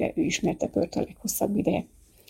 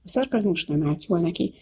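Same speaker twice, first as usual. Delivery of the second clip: usual speaking voice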